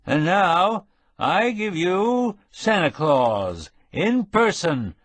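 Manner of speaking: put-on slow, high drunken mayor's introduction; tipsy mayor announcing to crowd. A narrator's reading. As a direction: present Santa grandly, slurring. Mayor introduces Santa, slurred.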